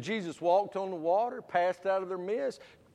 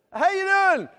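chirpy greeting; 8 dB louder than usual. Retold or imitated. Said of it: "I've been there".

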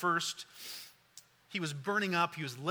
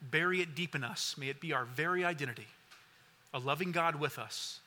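earnest prayer; 2 semitones lower than usual. Acted. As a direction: pray for the congregation, unhurried. Closing prayer.

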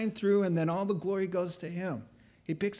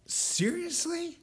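high, drawn-out disbelief; incredulous; 13 semitones higher, slower than usual. Acted. Preacher reacts to Gideon's complaint, incredulous. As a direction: stretch it high in mock disbelief.

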